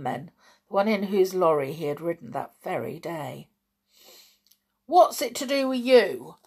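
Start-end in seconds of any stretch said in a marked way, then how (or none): 4.9-6.4 loud, higher angry retort. A narrator's reading. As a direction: snap back at the boy. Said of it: "said Peters angrily".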